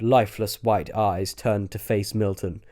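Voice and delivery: plain reading voice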